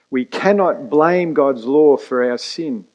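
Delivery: usual speaking voice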